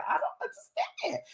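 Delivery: high-pitched, put-on puzzlement; mock bafflement. Streamer puzzled by shy viewers, mock bafflement. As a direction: squeak it out in mock confusion.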